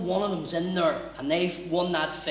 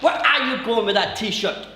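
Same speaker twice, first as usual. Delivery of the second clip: louder, higher, barked security guard; loud accusing security guard; gruff loud challenge; 5 semitones higher, 7 dB louder than usual. Retold or imitated. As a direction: snap it like a guard catching a thief. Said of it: "he didn't say it like that".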